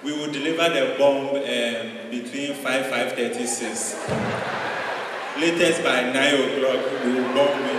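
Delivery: loud, halting mock Ghanaian announcement; polite Ghanaian bomber, loud with long pauses; Ghanaian accent. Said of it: "Ghanaian accent".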